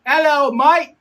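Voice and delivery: loud, high amateur Australian greeting; Australian accent. Pretend. Streamer greeting Australian viewers in a put-on Australian accent. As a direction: put on a big, high Aussie hello. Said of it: "I literally try to practice my Australian accent".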